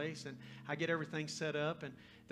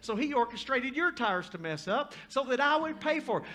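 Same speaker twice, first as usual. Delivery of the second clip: loud, raised-pitch testimony with driving emphasis; full-force preaching; 8 semitones higher, 7 dB louder than usual. Acted. Preacher telling the girl God arranged it, full-force preaching. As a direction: build it like an impassioned preacher.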